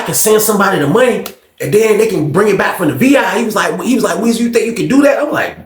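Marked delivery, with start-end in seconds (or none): 4.2-5.2 eager ask. Retold or imitated. Friend pushes him for a favour.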